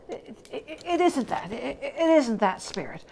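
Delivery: stammering protest, starting in a breathy near-whisper; flustered Scrooge. Acted. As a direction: catch yourself and insist. Scrooge stammering, defending Fezziwig.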